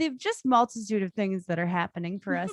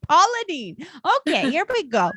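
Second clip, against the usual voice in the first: Paula Deen; 6 semitones higher, 6 dB louder than usual. Retold or imitated. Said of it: "Paula Deen's kinda like".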